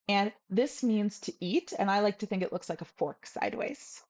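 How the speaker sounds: ordinary delivery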